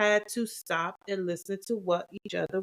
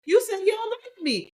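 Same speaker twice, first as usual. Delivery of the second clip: high, wounded retelling; mock hurt. Wife mimicking her own hurt reaction, high and mock wounded. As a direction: play the one who misheard, voice way up. Retold mishearing.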